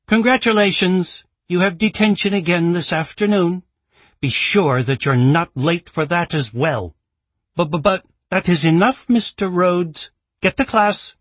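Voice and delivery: high, strict scolding schoolmistress voice; stern headmistress. Acted. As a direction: look down on him and scold. Principal hands out detention.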